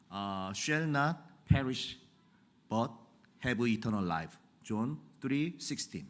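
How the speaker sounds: very slow, halting English with a thick Korean accent. Shown as a demonstration. Preacher jokingly reciting John 3:16 in Korean-accented English. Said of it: "한국인 본토 발음으로 얘기하면".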